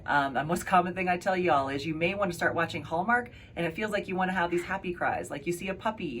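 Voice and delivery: usual speaking voice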